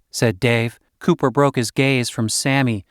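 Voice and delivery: plain reading voice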